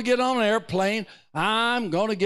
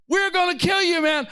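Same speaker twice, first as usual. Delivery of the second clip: higher, louder jeering threat; mock guard's threat; 9 semitones higher, 4 dB louder than usual. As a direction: bark it at the prisoner like a jailer. Guards threaten Paul again.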